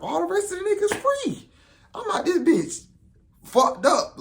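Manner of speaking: high laughing complaint. Real, not acted. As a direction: laugh through the words. Laughing at his own plight.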